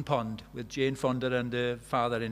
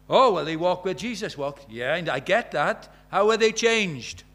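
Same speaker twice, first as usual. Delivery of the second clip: exasperated retort; 3 semitones higher, 7 dB louder than usual. Retold or imitated. Retold argument about Israel tour.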